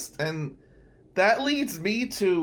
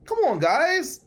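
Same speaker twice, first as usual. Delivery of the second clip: exasperated appeal; 11 semitones higher, 4 dB louder, slower than usual. Real, not acted. Pleading with the crowd.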